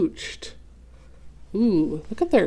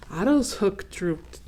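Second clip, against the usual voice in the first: ordinary delivery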